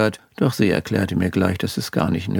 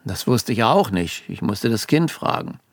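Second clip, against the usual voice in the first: ordinary delivery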